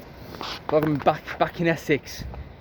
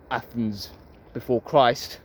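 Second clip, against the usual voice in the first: normal talking voice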